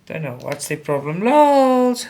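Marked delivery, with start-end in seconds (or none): 1.2-2.1 loud high call. Real, not acted. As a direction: call the dog's name. Calling the dog again.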